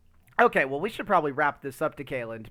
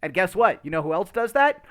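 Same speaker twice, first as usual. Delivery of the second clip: higher and louder into the punchline; mock outrage swelling to a shout; rising exasperation; 7 semitones higher, 9 dB louder than usual. Real, not acted. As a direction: build to the curse.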